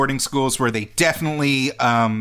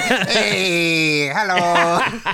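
imitating old lady; 4 semitones higher, much slower than usual.

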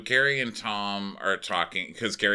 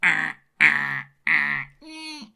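high, nosy question ending in imitated moans; put-on prying voice; mock suggestive teasing; 10 semitones higher than usual. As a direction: tease with hammed-up little grunts. Acting Ashley's suggestive question and noises.

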